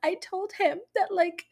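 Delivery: mock-tearful, high thin and soft; imitated tearful crying. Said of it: "Kit just starts crying".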